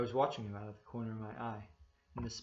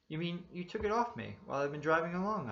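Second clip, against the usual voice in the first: higher, louder, stunned question; flabbergasted; 7 semitones higher, 5 dB louder than usual. A narrator's reading. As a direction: gasp it out in utter disbelief. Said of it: "I asked, flabbergasted".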